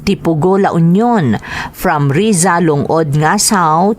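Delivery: ordinary talk, nothing marked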